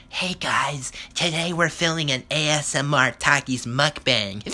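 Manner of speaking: mocking whispery ASMR intro; mimicking girl vlogger. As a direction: put on a whispered influencer voice. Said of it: "I hear one of these bitches go".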